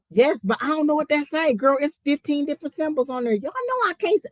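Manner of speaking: high-pitched squealing complaint; exasperated protest. Real, not acted. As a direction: plead your case in a high, exasperated voice. Protests that the name is unreadable.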